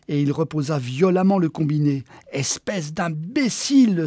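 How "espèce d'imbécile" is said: slow, biting self-reproach; self-insult. Acted. Man cursing himself for missing the call, slow and angry. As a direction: scold yourself, word by word. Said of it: "s'injuria-t-il".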